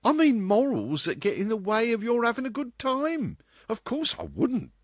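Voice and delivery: put-on very high, girlish character voice; protesting girl. A narrator's reading. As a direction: argue back in a high girl's voice. Same girl carries on arguing.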